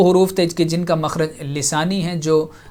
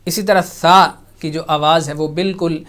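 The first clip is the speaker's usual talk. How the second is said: usual speaking voice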